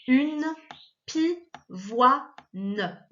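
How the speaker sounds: "pivoine" split into pi-voi-ne with clear gaps; syllable by syllable. Shown as a demonstration. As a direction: break "pivoine" slowly into pi, voi, ne. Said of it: "il est coupé en deux".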